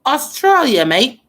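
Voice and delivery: calling out Australia, mate in a hammed-up Australian accent; mock Australian accent. Singing along to the song, trying a loud mock Australian accent. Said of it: "I'm not good at accents".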